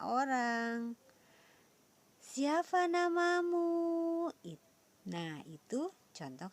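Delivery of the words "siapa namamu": high, slow questioning tune on "Siapa namamu?"; "Siapa namamu?" lifted high and drawn out; question intonation. Shown as a demonstration. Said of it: "Intonasi dalam kalimat tanya berbeda".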